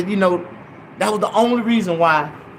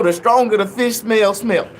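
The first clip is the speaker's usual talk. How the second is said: usual speaking voice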